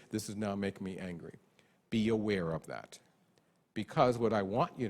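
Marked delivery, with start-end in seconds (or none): none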